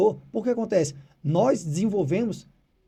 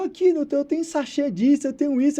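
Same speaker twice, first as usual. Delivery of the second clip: higher, hurried worried owner; anxious owner; 7 semitones higher, 5 dB louder than usual. Retold or imitated. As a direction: voice the fretting owner showing food. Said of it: "E ela mostrou".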